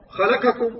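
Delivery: "خلقكم" سريعة بفتحات مخطوفة; اخطف فتحات "خلقكم" خطأً; اختلاس الفتحة. Shown as a demonstration. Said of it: "فماذا نقول نقرأ كذا نقرأها؟". (قراءة "خلقكم" ناقصة زمن الحركات)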